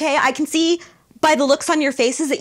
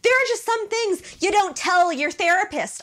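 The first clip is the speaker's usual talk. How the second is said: ordinary talk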